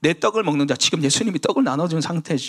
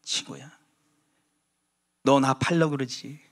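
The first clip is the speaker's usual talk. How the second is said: tender plea; 2 semitones lower than usual. Acted. Jesus tenderly pleading with Judas.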